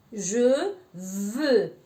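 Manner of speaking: je veux with rounded lips, ʒø vø; French je veux. Shown as a demonstration. Teacher modelling the rounded vowel in je veux. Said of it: "ponemos la boca como O y decimos E".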